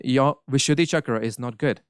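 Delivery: ordinary delivery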